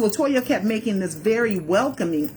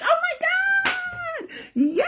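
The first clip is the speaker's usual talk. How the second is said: very high, slow, laughing squeal; giddy squeal; much slower than usual. Retold or imitated. Fan told LaToya will take pictures, laughing squeal. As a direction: stretch it out in delighted disbelief. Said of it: "I was like".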